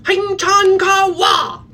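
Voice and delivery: very high, loud, drawn-out scream; screaming mock language. Shown as a demonstration. Coach showing clients how to interrupt an argument, screaming mock language. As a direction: shriek it slowly like a pretend foreign language. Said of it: "screaming, excuse me, in another language".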